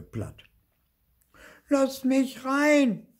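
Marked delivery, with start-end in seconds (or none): 1.7-3.1 very high, drawn-out yell; bellowing. A narrator's reading. Tiny ghost at the café window, bellowing. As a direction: yell it slowly like a demanding child. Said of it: "brüllte er".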